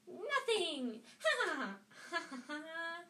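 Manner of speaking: high, crowing cackle; mischievous mock laughter; gloating imp. A narrator's reading. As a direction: crow the word, then laugh in staccato. Peeves gloats at tricked Filch.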